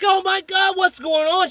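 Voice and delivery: high-pitched panicked outcry, retold; mock-distressed high voice; imitated distraught woman. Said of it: "She started crying, bawling".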